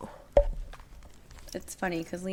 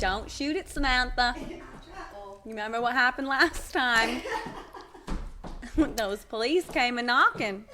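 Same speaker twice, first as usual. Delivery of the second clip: mob wife scolding; 4 semitones higher, 3 dB louder than usual. Pretend. Mock mob wife warning.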